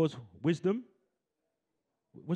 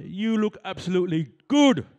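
loud, raised-pitch hype, punching the last word; rousing call; 5 semitones higher, 7 dB louder than usual. Shown as a demonstration. Host has audience compliment their neighbours, rousing call. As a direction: call it out to fire up the crowd.

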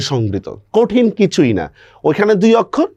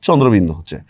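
ordinary delivery

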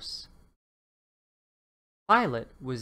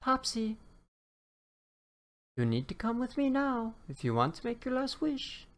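high, hurried leprechaun; urgent leprechaun; 9 semitones higher than usual. Acted. Leprechaun hurries the dinosaur to the rainbow, high and urgent. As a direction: rush him toward the rainbow.

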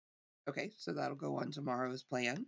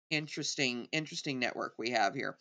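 usual speaking voice

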